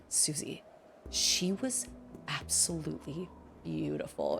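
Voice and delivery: slow, hushed imitation of awe; awestruck hush. Brother to Susie about the beautiful star, awestruck. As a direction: drop low and soft, as if starstruck.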